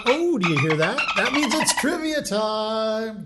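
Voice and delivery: loud, high, hyped-up host call; playful drawn-out fanfare announcement; game-show announcing. Pretend. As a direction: announce the quiz segment with a big flourish. Host announces the trivia segment.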